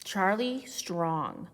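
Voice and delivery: usual speaking voice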